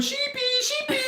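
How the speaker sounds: very high, sing-song call through laughter; Rachel impression. Owner mimicking Rachel calling the lamb, very high and laughing. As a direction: squeak it out like Rachel calling her lamb, giggling. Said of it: "I'm trying to sound like Rachel".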